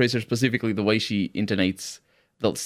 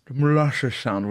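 slurred Southern "molasses"; mushy drawled Southern accent; 3 semitones higher, slower than usual. Shown as a demonstration. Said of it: "are you the drawl South".

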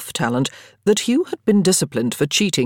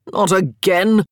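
louder, weary, fed-up protest; exasperated groan; 3 semitones higher, 5 dB louder, slower than usual. A narrator's reading. Marcus groaning at Daniel's boast, weary and fed up. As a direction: sigh it out, tired of this. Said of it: "Marcus groaned".

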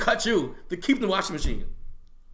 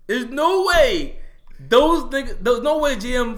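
insistent loud; 5 semitones higher, 5 dB louder, slower than usual. Real, not acted. Insisting in argument.

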